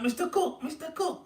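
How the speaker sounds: high-pitched imitation of kids calling. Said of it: "they're going like".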